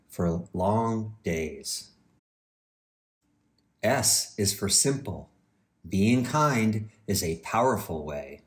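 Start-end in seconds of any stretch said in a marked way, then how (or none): none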